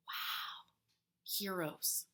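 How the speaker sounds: normal talking voice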